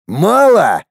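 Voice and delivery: barking. Acted. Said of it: "рявкнул я".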